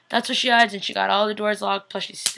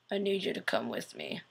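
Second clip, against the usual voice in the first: much lower, softer dazed vampire voice; weird low voice; 9 semitones lower, 11 dB quieter than usual. Retold or imitated. Stefan voiced low and soft, blood going to his head. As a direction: drop low and quiet like he's drunk on blood. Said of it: "he's talking so weird".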